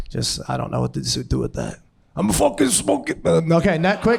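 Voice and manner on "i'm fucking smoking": high, mock Italian bear voice; Italian smoker bear. Comic imagines a chain-smoking bear, mock Italian voice. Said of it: "a chain smoking Italian bear".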